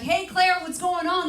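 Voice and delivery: put-on high, bubbly girlfriend greeting; chirpy girlfriend. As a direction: call out brightly like a chatty friend. Girlfriends calling on phone.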